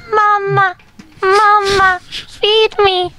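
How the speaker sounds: high eerie doll pleading "Mama"; a haunted doll begging to be fed; creepy doll voice. Acted. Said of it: "I just be like".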